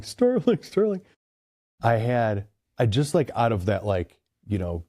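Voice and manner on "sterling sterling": high mock holler for a lost dog; imitated calling yell. Driver calling his dog down the road, mock yell. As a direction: sing the name out high, short of a real shout.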